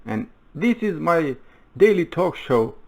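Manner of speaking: punchy, swooping host intro; show-opening announcement. Acted. Host greeting the world on a daily talk show, announcing. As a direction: open the show with big, rising-and-falling emphasis.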